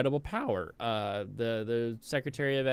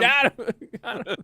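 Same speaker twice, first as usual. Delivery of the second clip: laughing gloat; 12 semitones higher, 5 dB louder than usual. Real, not acted. Laughing triumph.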